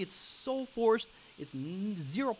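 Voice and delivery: slow, exasperated drawl; drawn out. Real, not acted. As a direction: stretch it out in disgust. Emphatic complaint.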